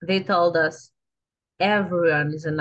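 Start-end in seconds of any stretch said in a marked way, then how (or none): none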